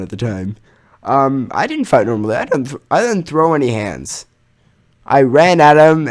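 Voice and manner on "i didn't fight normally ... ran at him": ordinary talk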